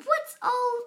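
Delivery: loud high shouted question; shouting crowd. A narrator's reading. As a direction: crowd calling out in wonder. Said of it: "The people came shouting".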